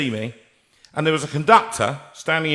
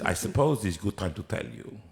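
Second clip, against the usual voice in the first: put-on Russian accent; 4 semitones lower, 8 dB quieter than usual. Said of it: "suddenly become Russian".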